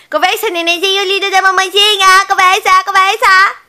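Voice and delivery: put-on loud squeaky baby talk; squeaky praising a dog. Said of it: "Cala a boca! Com essa voz aí! Essa voz irritante".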